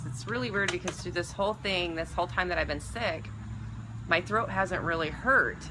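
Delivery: ordinary talk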